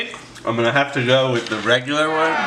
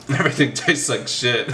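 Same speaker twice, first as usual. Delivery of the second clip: laughing; 7 semitones higher than usual. Real, not acted. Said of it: "I'm just kidding".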